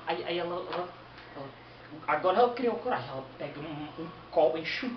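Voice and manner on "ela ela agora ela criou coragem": ordinary delivery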